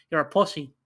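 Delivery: usual speaking voice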